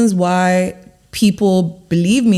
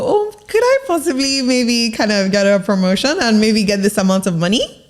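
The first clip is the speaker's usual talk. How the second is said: unsure asking; 3 semitones higher than usual. Shown as a demonstration. Coach mimicking a timid worker asking for promotion, hesitant and unsure. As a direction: ask meekly, piling up the maybes. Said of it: "you don't go".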